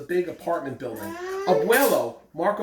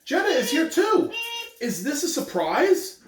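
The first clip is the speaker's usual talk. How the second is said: put-on excited child; 9 semitones higher than usual.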